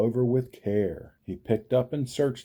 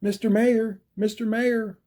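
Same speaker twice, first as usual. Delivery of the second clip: calling out; 12 semitones higher, 3 dB louder than usual. A narrator's reading. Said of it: "Horton called".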